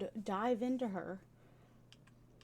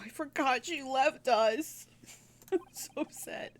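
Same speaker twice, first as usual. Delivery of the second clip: ordinary delivery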